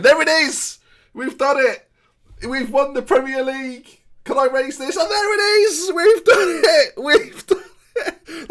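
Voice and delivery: high, loud victory screams; ecstatic yelling. Real, not acted. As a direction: scream it out, title won, voice cracking. Title win celebration.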